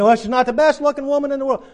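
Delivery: hammed-up scoffing objection; mock objection. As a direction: play the doubting man scoffing. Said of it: "You say".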